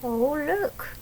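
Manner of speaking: ordinary delivery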